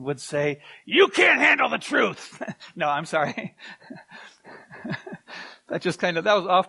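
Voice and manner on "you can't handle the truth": clipped movie-star growl, higher and louder; Jack Nicholson. Retold or imitated. Pastor answering his wife as Jack Nicholson, mock intense. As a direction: deliver the famous line like a courtroom film. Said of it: "in my best Jack Nicholson impersonation".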